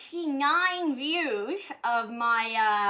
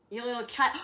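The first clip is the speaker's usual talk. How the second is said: normal talking voice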